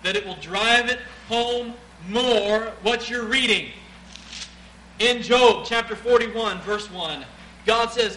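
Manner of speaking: loud, high preacher's build; preaching at full force. Acted. Preacher announcing Job to the congregation, loud. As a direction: drive it home to the room.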